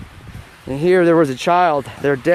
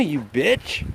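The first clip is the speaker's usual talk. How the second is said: normal talking voice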